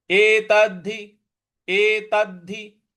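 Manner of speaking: एतद्धि with a held, doubled द; एतद्धि said slowly, pressing the doubled द; doubled d stressed. Shown as a demonstration. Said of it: "उसके बाद आधा द है जिस पे हमको बल लगाना है".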